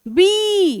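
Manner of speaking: "बी" with a stretched ee; long ee demo. Shown as a demonstration. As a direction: draw out the vowel in "बी". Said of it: "कैसे?". ("बी" में ई को लंबा खींचें)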